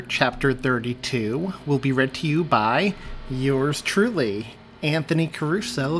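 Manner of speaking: ordinary delivery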